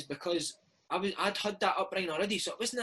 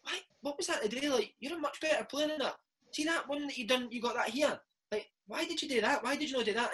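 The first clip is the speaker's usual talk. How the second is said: higher-pitched, earnest coach voice, imitated; imitating Lenny; 7 semitones higher than usual. Manager with hand on player after training, higher imitated questioning. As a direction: quiz the kid in a raised mock-manager voice. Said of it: "you'd be like".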